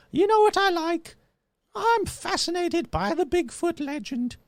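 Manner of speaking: very high, put-on royal falsetto; bad Queen Elizabeth impression. Host mimics the Queen confessing her Bigfoot love, hammy high falsetto. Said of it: "here's my bad Queen impression".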